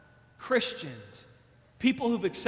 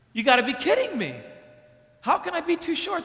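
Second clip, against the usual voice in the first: put-on disbelieving kid; 8 semitones higher than usual. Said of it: "And I was like".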